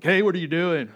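loud, high mock holler; imitating a brother-in-law calling out; intruding shout. Brother-in-law barges into the prayer.

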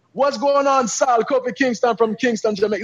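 high, put-on Jamaican accent. Wrestler greeting a magazine interviewer in character, fake Jamaican accent. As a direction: switch into a hammed-up Jamaican accent, pitched high. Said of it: "like, in my accent".

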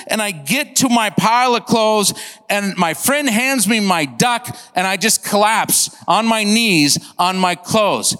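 normal talking voice

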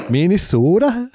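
high, slowed mock Minnesotan; Minnesotan accent. Host retrying after it went Scottish, mock Minnesotan accent. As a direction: another try at a Minnesota twang. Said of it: "that was a pretty good Minnesotan accent".

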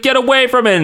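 high, sharp protective order; protective yell. Retold or imitated. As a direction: shoo the monster away. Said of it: "and he's like".

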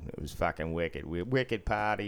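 put-on broad Boston accent, dropped r's. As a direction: talk like an old-school Boston local. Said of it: "Boston used to be".

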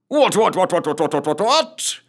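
high-pitched run of jolly bluster; jovial captain. A narrator's reading. Captain blustering in to greet his troop, jovial. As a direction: rattle it off jovially, rising at the end. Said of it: "intoned the captain jovially".

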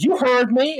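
high, sassy comeback; mock defiant caller. Rude woman answering the agent back, mock defiance. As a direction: imitate a caller standing her ground.

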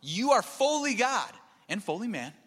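high, insistent exasperation; indignant, emphatic protest; frustrated. Retold or imitated. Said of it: "He's frustrated".

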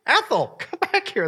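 high mock call after a friend; lightly voiced old lady shouting across the room. Old lady calling her friend back from the toilet, high mock call. Old ladies leaving the bathroom.

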